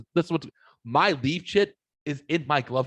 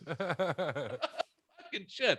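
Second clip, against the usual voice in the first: wheezing the words out very slowly, softer; breathless laugh; 4 semitones higher, 10 dB quieter, much slower than usual. Real, not acted. Host still laughing at his own story, wheezed out. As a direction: barely get it out through laughter.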